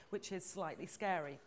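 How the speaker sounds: usual speaking voice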